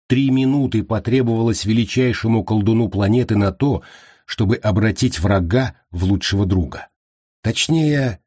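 ordinary delivery, nothing marked

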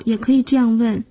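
ordinary talk

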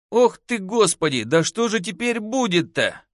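high, fretful lament; anxious, high-pitched whine; whining dismay. A narrator's reading. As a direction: wail as if all is lost. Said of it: "продолжал скулить Костка".

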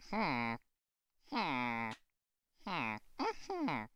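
soft, mild villager hums, fading to quiet; Minecraft villager. Acted. Host shows the co-host how the villagers sound, humming softly. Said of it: "but the Minecraft villagers".

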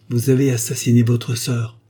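accusation lancée brusquement, plus fort; assène-le d'un coup sec; brusque. A narrator's reading. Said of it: "lui dit-elle brusquement". (duchesse accusant brusquement le baron)

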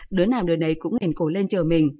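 ordinary delivery, nothing marked

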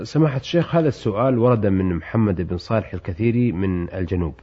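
formal standard arabic. Acted. Host reads listener's letter.

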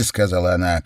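ordinary delivery

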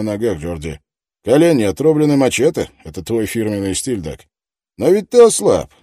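loud, pushy interrogator; tough detective voice. Acted. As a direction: press him hard, loudly. Detective presses the suspect.

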